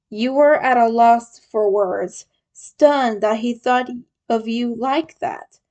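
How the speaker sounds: ordinary delivery, nothing marked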